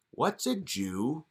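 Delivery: put-on slow, quiet, baffled child; puzzled kid. Vermont kid answering the politician, slow and quiet. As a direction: ask it slowly like a confused kid.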